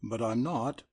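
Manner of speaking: ordinary delivery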